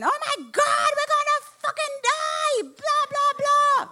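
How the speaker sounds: high, loud panicked shriek; panicked scream. Retold or imitated. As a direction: scream it like the bus is crashing. Panicked passenger screaming, retold.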